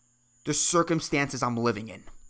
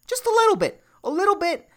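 much higher, teasing little voice; high mocking voice; 5 dB louder, faster than usual. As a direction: squeak it out, mocking.

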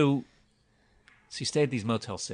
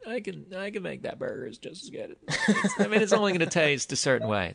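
laughing; 7 semitones higher than usual. Real, not acted. Laughing at own burger claim.

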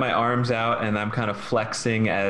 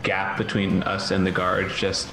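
ordinary talk

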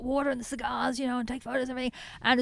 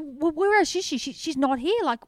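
retold panic; 6 semitones higher than usual. Founder reliving the search for the lost calf, mildly panicked. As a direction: replay the alarm at the missing calf, lightly. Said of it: "I was really getting a panic".

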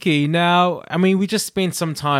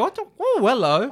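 surprised; 10 semitones higher than usual. Retold or imitated.

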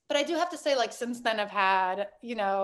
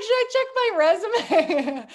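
higher, louder and a bit slow, laughing through it; laughing mock panic; 9 semitones higher, 6 dB louder, slower than usual. Founder joking about giving up on her startup, laughing as she asks. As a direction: ask it half-joking, half-panicked.

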